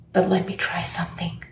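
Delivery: low secretive whisper, imitated; conspiratorial whisper. Lady at counter leaning in to customer, conspiratorial whisper. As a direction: lean in and whisper it like a secret favor. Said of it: "she leans in and she goes".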